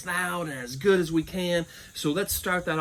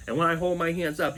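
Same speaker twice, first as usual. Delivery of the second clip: ordinary talk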